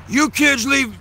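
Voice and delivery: hammed-up irate neighbour; angry grandpa.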